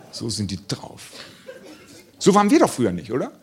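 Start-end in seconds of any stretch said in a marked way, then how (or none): 0.1-1.0 much lower, softer mutter of the same line; muttered aside. Shown as a demonstration. Comedian repeats his complaint about schoolkids as a low mutter. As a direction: repeat it under your breath. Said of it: "Ja, so sind die drauf".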